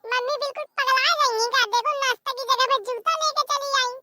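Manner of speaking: put-on high, kid-like tattling to mummy; a little girl gleefully telling on her mother; mischievous child voice. Child figure mocks mother.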